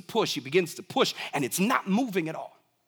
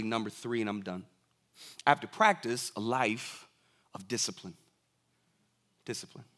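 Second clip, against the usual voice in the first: soft earnest close; 7 semitones lower, 5 dB quieter than usual. Real, not acted. Soft closing list of points.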